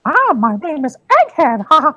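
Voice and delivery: squeaky cartoon character; silly high character voice. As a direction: play a goofy high-voiced host. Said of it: "I like this character".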